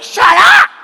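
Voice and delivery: very high, loud screech; imitated angry shout. People in the car yelling at speaker, high loud screech. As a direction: screech it back, hammed-up. Others shout back in quarrel.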